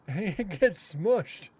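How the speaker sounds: chuckling through the words; slow, laughing payoff. Real, not acted. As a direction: laugh as you deliver the punchline. Laughing at his own grim joke.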